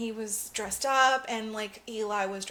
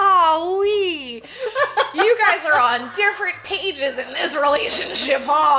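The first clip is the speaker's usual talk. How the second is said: laughing mockery; 5 semitones higher, 7 dB louder than usual. Mocking awkward couple.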